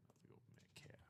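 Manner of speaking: whispered pick. Real, not acted. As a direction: breathe it out under the voice.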